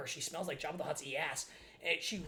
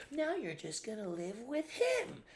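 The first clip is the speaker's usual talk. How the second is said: mocking mom voice; 7 semitones higher, slower than usual. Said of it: "she was like".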